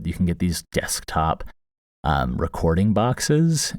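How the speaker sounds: ordinary delivery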